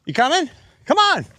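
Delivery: high, ringing call uphill. Real, not acted. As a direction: call them to hurry along. Calling companion up hill.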